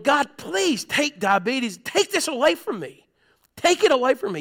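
high, slow, imploring cry to God; pleading prayer. Retold or imitated. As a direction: beg God to lift the burden. Retold desperate prayer on couch.

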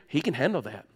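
very low, soft reassurance; quiet, unbothered reply; nice and calm. Retold or imitated. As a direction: answer gently, dropping right down in pitch and volume. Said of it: "tell them in my nice way".

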